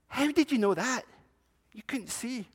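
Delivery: put-on high, astonished child asking; boy can't believe she knew; amazed boy. Boy amazed the old woman guessed the bird, high and astonished.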